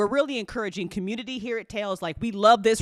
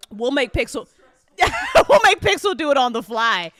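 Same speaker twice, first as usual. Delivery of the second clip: louder, laughing as you say it; laughing shout; 4 semitones higher, 7 dB louder than usual. Real, not acted. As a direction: shout out the joke mid-laugh. Joking shout through laughter.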